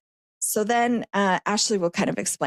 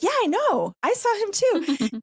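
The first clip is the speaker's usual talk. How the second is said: high-pitched eager agreement, the last words slower; lightly voiced sister, excited and high; mock eager sister; 11 semitones higher than usual. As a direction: play the sister chiming in brightly. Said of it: "And she's like".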